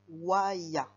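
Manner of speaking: "waya" loud and stretched over the glide; dictated syllables. Shown as a demonstration. Teacher dictating syllables to write, loud, drawn-out "waya". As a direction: project "waya", drawing out both syllables. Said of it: "je vais te dire des syllabes".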